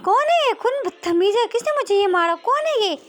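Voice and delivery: very high, loud yelp of protest; shrieky angry demand; startled outrage. Acted. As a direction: shout as if hit awake. Girl woken by pillow hit.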